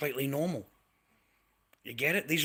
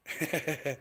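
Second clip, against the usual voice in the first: real chuckle; 6 dB quieter than usual. Real, not acted. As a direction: laugh softly at the other person's argument. Laughs at the viewer's argument.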